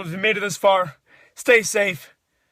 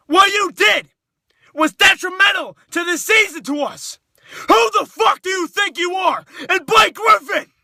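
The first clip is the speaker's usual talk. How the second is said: furious shouting; 6 semitones higher than usual. Real, not acted. Fan raging at players.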